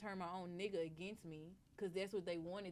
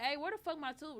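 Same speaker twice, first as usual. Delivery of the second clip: angry demand; 7 semitones higher, 4 dB louder than usual. Retold or imitated. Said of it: "I'm like".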